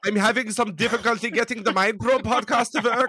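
high, laughing mock German accent; hammed-up German accent, laughing. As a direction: complain like a German passenger. German accent bit continues.

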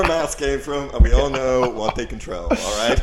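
joking about a conspiracy, a loud mock rant. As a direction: declare it like a loud crank.

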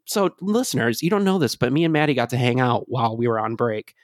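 ordinary talk, nothing marked

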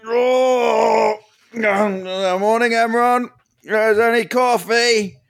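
high, bright-eyed morning call; cheery morning greeting. Acted. Hungover rogue greets empty room cheerily.

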